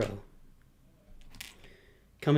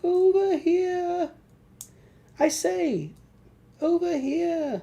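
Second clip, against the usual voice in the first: much higher, slow squeaky call; slower than usual. Pretend. Toy figure calling to the builder in a squeaky voice.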